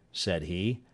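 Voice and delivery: ordinary delivery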